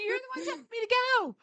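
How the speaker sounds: very high, fast outraged squeak; mock-indignant, laughing; pushing back at husband's teasing; exasperated protest. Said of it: "I'm like".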